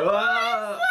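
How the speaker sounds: loud, very high-pitched mock scream; hammed-up magical transformation cry. Crone transforming into an enchantress, hammed-up scream. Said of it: "that's Geraldine transforming into an enchantress".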